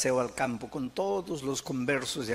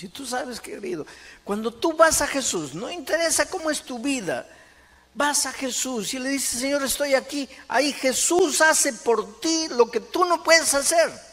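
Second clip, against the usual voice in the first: high, loud evangelistic appeal; preaching at full force; 12 semitones higher, 10 dB louder than usual. Real, not acted. Preacher calling the congregation to Jesus, full-force exhortation. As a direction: drive the promise home, loud and high.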